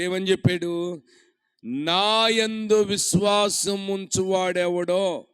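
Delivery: loud, slowing on the scripture; full-force preaching. Acted. Preacher proclaiming Jesus cried out loudly, loud and slow. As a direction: proclaim each phrase with weight.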